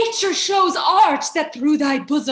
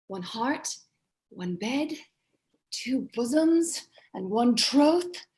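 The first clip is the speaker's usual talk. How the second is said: tender; 3 semitones lower, 3 dB quieter than usual. Acted. Lover woos softly.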